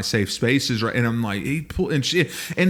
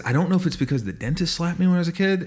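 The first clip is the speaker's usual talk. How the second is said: higher, quick, tossed-off casual; breezy offhand; 6 semitones higher, faster than usual. Retold or imitated. Telling the therapist, casually tossed off. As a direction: say it like it's nothing. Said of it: "And I said it like that".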